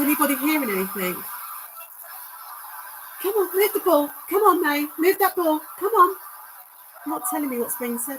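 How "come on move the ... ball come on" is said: high, louder dog owner coaxing; urging pet talk. Acted. Urging the dog spirit to move the ball, high coaxing pet voice. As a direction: egg the dog on to push the ball.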